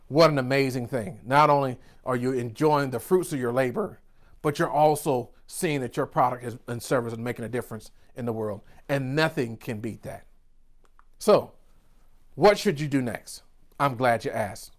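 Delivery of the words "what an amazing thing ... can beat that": softer, lower, heartfelt reflection; sincere. Real, not acted. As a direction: drop down and speak from the heart. Reflecting on purpose.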